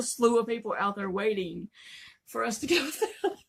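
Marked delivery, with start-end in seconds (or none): 2.3-3.4 fast, breaking into laughter; laughing. Real, not acted. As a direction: laugh through the words. Laughing at the crowd waiting.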